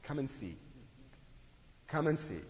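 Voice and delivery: soft, quiet invitation; a quiet call to come along; gentle invitation. Retold or imitated. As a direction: say it softly, inviting them in. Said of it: "He says it twice".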